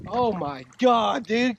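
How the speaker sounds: ordinary talk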